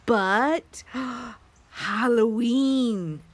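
very slow, drawn-out mock dread; playfully spooky. Teasing kids about Halloween, hammed-up spooky. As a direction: stretch it out like a ghostly howl.

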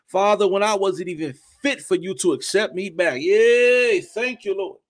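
slow, earnest thanks poured out to God; heartfelt prayer. Acted. Preacher breaking into prayer before the congregation, heartfelt. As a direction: pray it aloud, grateful and moved.

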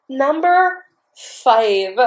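high, drawn-out triumphant announcement; excited disbelief. Retold or imitated. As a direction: stretch each word out in amazement. Reliving excitement at queue number.

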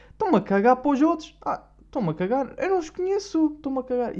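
high mocking voice; mocking don't-care attitude. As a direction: sneer it like a cliquey kid. Voices the cliquey guys' attitude.

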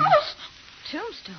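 very high startled oh; shocked gasp. Acted. Girl learning she tripped on a tombstone, high shocked cry.

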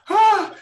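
a sudden, very high, loud cry of relief; joyful yell. Retold or imitated. He sees his ankle is intact and yells. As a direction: let out an elated shout.

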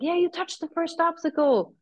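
high-pitched mock accusation; retold insistence. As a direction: mimic a marshal insisting, voice well up.

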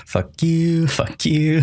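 high, drawn-out playful taunt; gleeful pretend send-off; mock taunting. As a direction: tease the enemies in a put-on high voice. Joins in mocking dream enemies.

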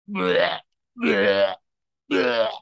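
gagging bleh of disgust, three times; mock gag at banana flavor. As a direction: spit the taste out, bleh bleh bleh.

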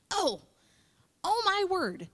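high, loud mock shock; startled shock. Reacting to something zipping through your mind, startled. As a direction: exclaim it high, as if caught by a thought. Acting startled by a passing thought.

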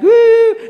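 high, loud "woo"; gleeful whoop. Pretend. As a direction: whoop in mock triumph. Imagined gloating at manager being fired.

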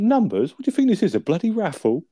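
high, loud mock outrage; incredulous high outburst. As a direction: squeal it like it's absurd. Del incredulous at Rodney.

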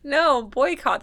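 put-on boycott in a light Midwestern accent. Shown as a demonstration. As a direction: say boycott the Ohio way. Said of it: "just my Midwestern accent".

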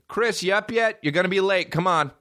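high-pitched morning wake-up call; imitated nagging mom. Mom rousing him every morning, high nagging call. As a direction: mimic a mom calling her kid up.

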